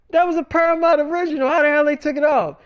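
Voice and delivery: can't believe they pulled it; outraged disbelief. Retold or imitated. Indignant retold disbelief.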